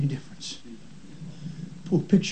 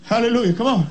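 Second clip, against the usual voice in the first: ordinary talk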